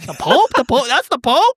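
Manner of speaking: hammed-up double take; mock disbelief. Realising the passing man is the Pope, rising mock disbelief.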